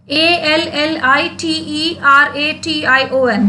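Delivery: each letter name of "A L L I T E R A T I O N" said separately; spelled out. Shown as a demonstration. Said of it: "this is the spelling of alliteration".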